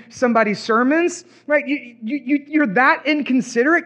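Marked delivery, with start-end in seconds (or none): none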